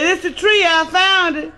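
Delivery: high, eager and laughing; high-pitched chuckling reveal; proud announcement. Acted. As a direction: show off your find proudly. Man proudly shows tiny bush.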